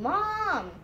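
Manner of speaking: mild storybook yell at a high pitch; yelling for mom. A narrator's reading. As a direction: call out a long high "Mom!".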